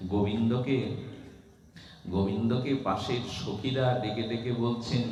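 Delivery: normal talking voice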